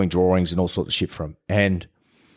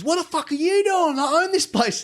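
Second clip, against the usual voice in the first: high, furious yell at the dad; indignant landlord yelling "I own this place"; angry owner shouting. Retold or imitated. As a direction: bark it like an owner who thinks he's in charge.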